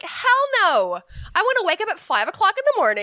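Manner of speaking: high, loud retold protest; mock indignant refusal. Child answering parents about Santa coming early, mock indignant refusal. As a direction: refuse like an outraged kid. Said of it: "And I'd be like".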